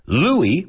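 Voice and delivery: "Louis" said like LOO-ee, final s silent; French pronunciation. Shown as a demonstration. Comic introducing the French king, "Louis" pronounced LOO-ee. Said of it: "we don't say Louis. We say Louis, like the French pronunciation".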